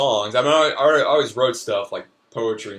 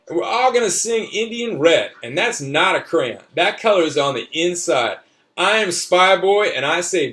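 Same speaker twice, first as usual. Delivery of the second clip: put-on a young boy telling his adventure, pitched up; child's voice; 8 semitones higher, 5 dB louder than usual. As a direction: read it like an eager little kid. Child narrator reads his story.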